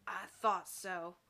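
slow, low knowing cowboy; suspicious. Acted. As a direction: say it grimly, suspicion confirmed. Tex suspicious.

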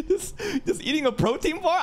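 high-pitched squeal of astonishment; shriek-laugh in utter bafflement; amused disbelief. Real, not acted. Startled laughing at new character.

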